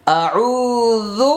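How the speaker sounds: আউযু with the proper য sound, slow and chanted; correct recitation. Shown as a demonstration. Teacher giving students the right form, slow and chanted. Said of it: "ভুল হবে".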